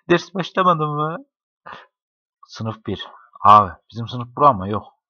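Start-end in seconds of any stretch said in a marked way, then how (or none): 0.1-1.2 higher and slower, teasing "Ders başlamadı mı?"; mock-high drawn-out question. Shown as a demonstration. Friends joking about class starting, mock whiny high question. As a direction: stretch it up high like a whiny kid. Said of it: "Hani ders başlamadı mı?".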